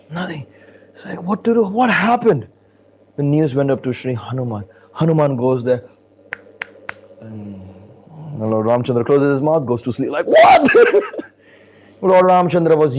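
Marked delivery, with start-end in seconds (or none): 1.2-2.5 high, alarmed questioning; imitating worried onlookers; acted alarm. Family alarmed at the lifeless Lord. 10.3-12.0 very high, loud yelp of disbelief; hammed-up shocked cry; comic shock. Onlookers' shocked reaction, comic.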